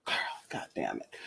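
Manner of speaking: breathy whispered curse under her breath; exasperated whisper. Real, not acted. Vlogger whispering to herself after spotting the possum, exasperated whisper. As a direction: mutter it to yourself, fed up and hushed.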